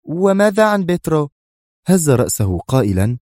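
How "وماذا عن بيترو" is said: much higher, louder anxious question; tense. A narrator's reading. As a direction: ask with rising worry. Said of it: "قالت في توتر".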